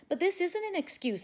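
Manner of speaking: high, pleading protest; protesting cry. A narrator's reading. Boy protesting to his teacher, high and pleading. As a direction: insist it isn't an excuse, voice jumping up. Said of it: "Stevie cried".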